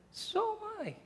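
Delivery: tiny, high, very soft reply; coy little aside; understated answer. Acted. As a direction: answer in a small hushed voice. Understated answer to loud challenge.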